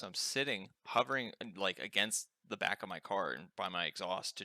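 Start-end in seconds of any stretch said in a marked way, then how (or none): none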